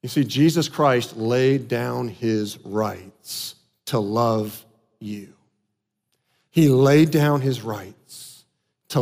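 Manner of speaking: low, soft, slow gospel appeal; solemn preaching. Real, not acted. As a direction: bring it right down, measured and tender.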